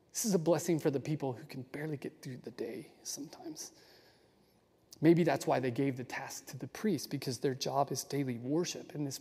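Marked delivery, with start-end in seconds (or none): 0.1-3.7 tender breathy whisper. Real, not acted. Teacher whispering the blessing for the weary, tender. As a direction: whisper it like a comfort. Tender close on the struggling people.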